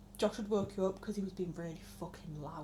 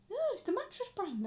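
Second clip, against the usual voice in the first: grandad correcting; 10 semitones higher than usual. Retold or imitated. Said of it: "And he went".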